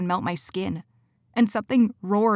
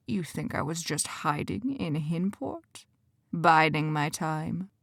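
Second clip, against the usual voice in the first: much softer, slow villain taunt; slow soft menace; 5 dB quieter, slower than usual. Acted. Quentin scoffs at Cora, slow and soft. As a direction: drag out the mockery.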